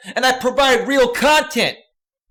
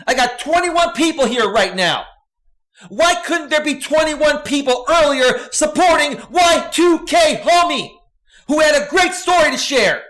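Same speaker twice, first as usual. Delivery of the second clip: real angry rant; 4 semitones higher than usual. Real, not acted. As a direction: let the anger boil over into a shout. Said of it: "I'm getting angry and I'm getting triggered".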